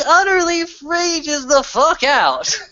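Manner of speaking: climbing to a high, loud roar; 1 rising rage. Acted. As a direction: let the words burst out big. Story peaks at frenzy.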